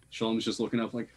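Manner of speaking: ordinary delivery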